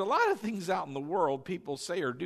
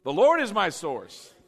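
emphatic, louder declaration; like you mean it; 2 semitones higher, 8 dB louder than usual. Shown as a demonstration. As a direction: declare it with full conviction. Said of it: "say it like you mean it".

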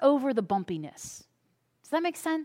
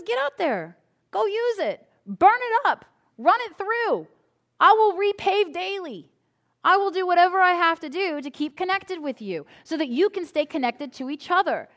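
God urging; 5 semitones higher than usual. Retold or imitated. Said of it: "God says".

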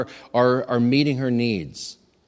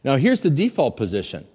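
usual speaking voice

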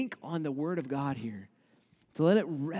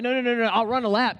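higher, louder, fast dismissive protest; mock brush-off; 5 semitones higher, 5 dB louder, faster than usual. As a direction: wave God off quickly with a hammed-up no-no-no. Mock reply to God's call.

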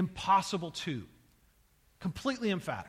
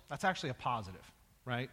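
normal talking voice

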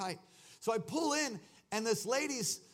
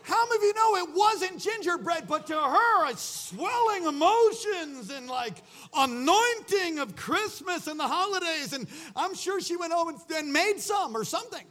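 high, loud, worked-up delivery; full-force comic preaching; 9 semitones higher, 6 dB louder than usual. Real, not acted. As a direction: shout it out to the whole congregation.

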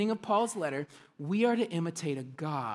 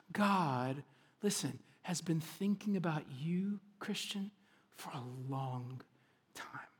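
whisper; 3 semitones lower, 8 dB quieter than usual. Real, not acted. Pastor hushing the church about God's love. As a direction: draw them in with a hush. Tender aside to congregation.

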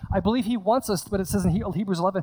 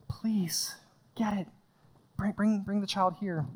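urgent whisper; 6 dB quieter, slower than usual. Acted. Jesus pleading to disciples.

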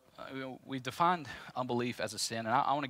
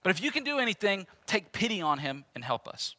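desperate father's plea, pitched up; pleading father; 8 semitones higher, 3 dB louder than usual. Acted. Preacher voicing father begging Jesus for help, pleading. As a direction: beg Jesus to help your boy.

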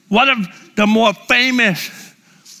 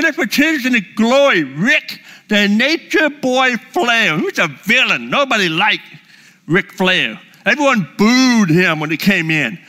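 ordinary talk

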